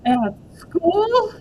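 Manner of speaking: high, loud shocked repeat; the horror sinks in; alarmed. Acted. May realises it's at school.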